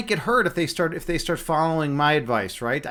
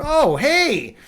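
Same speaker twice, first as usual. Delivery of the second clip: excited greeting; 6 semitones higher, 5 dB louder, slower than usual. Real, not acted. Greeting a viewer arriving in chat.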